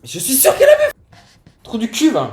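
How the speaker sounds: high, loud laughing yell; laughing shout. Real, not acted. Heated joking argument among friends.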